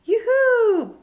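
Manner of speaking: loud sing-song call, pitched high; playful high calling voice. A narrator's reading. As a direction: call out to hidden friends across the camp. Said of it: "Big Bird called".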